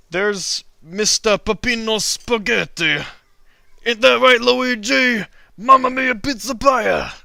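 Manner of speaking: loud, high Italian chef voice; hammed-up Italian accent, Mamma mia; jolly italian pizza chef. Drawing Peppino, Italian chef voice.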